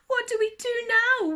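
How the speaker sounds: very high, loud frightened wail; wailing hedgehog. Acted. Hedgehog stuck up the tree, wailing high and loud. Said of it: "wailed the hedgehog".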